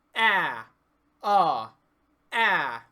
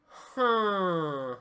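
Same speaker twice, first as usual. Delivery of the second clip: hummy sung huh through the nose; nasal buzzy huh; 5 dB louder than usual. Shown as a demonstration. Teacher demonstrating a nasal buzz on a vowel. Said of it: "If you say that on a vowel".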